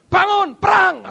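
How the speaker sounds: rally the troops; God commands him to rise and fight, shouting; shouted battle order. Acted.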